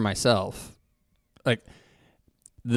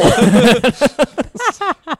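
laughing; 8 dB louder than usual. Real, not acted. Laughing after the quote.

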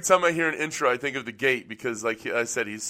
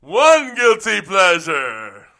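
Count voice; 4 semitones higher, 9 dB louder, much slower than usual. Pretend. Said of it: "You doing the count?".

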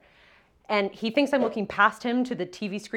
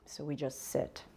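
normal talking voice

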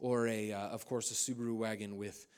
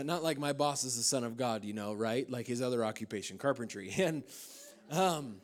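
ordinary delivery

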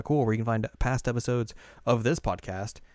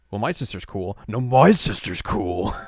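bratty sibling bickering; 8 dB louder, slower than usual. Acted. Mock sister rivalry argument.

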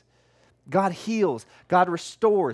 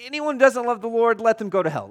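higher, louder, driving it home; the curse restated with raised, forceful delivery; emphatic paraphrase; 5 semitones higher, 5 dB louder than usual. Retold or imitated. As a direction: spell out the curse bluntly. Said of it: "that's literally what he is saying".

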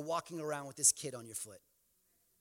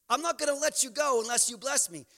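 bold demand; 5 semitones higher, 4 dB louder than usual. Acted. Repeats Jacob's bold demand.

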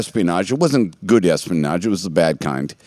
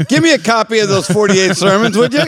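laughing; 10 semitones higher than usual. Real, not acted. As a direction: tease while still laughing. Joking aside while laughing.

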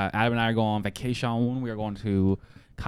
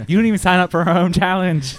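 a touch of hammed-up anger, a bit louder; mock outrage; 8 semitones higher, 7 dB louder than usual. Host mock-scolding Evan for skipping their own challenge, half-joking anger. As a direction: playfully chew him out.

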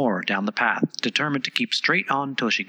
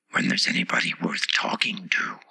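a proud plant whispering; whispery flower voice; 7 semitones lower than usual. A narrator's reading. Tiger Lily's voice.

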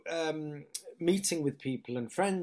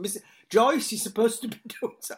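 mock cinema manager; 8 semitones higher, 7 dB louder than usual. Retold cinema telling-off.

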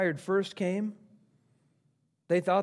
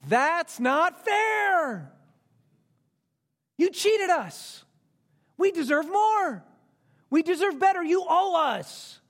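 put-on high, drawn-out childish protest; indignant grumbling; 8 semitones higher, slower than usual. First-hired workers protesting their pay, acted indignation. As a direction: wail it like a cheated worker, hammed-up. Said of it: "They grumbled".

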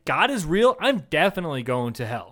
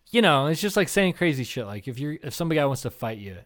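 usual speaking voice

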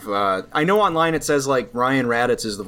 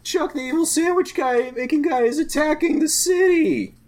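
high, slow melodramatic distress call; mock cry for help; 13 semitones higher, slower than usual. Host acts out the city under villain attack, high mock panic. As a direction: wail in cartoonish alarm.

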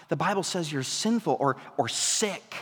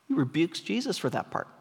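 ordinary talk

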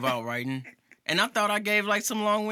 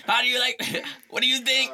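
usual speaking voice

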